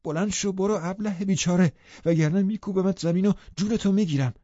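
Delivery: furious shout to drive her out; sudden shout. A narrator's reading. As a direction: yell it suddenly, threatening. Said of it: "یهو داد کشید".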